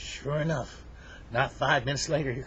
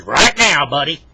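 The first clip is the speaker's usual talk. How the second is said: very loud, forceful order; mean bear; 4 semitones higher, 15 dB louder than usual. Acted. Bear orders Henry to obey, mean and forceful. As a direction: shout down the protest. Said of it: "the bear's really mean".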